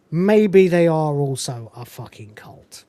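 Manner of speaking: ordinary delivery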